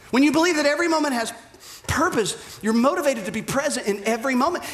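preacher hammering the point the second time. Shown as a demonstration. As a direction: rush the repeat with urgency. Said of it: "repeated for emphasis".

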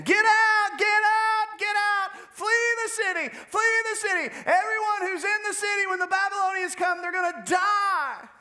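high, loud shouting through the streets; the prophet shouts a warning to everyone in Jerusalem. Acted. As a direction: cry the warning out to the whole city. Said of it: "went to the streets of Jerusalem saying".

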